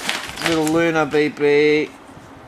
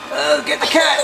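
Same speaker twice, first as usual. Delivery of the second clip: usual speaking voice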